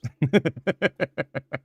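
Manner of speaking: high, rapid run of giggles; teasing giggle. Real, not acted. Streamer laughing after teasing a chatter about his games, teasing giggle. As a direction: giggle away after the tease.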